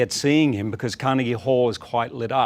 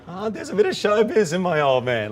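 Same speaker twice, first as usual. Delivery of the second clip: much higher, delighted exclamation; higher, thrilled aside about his dad; delighted surprise; 9 semitones higher than usual. Retold or imitated. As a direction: burst out as if you can't believe your dad's flair. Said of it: "I'm like".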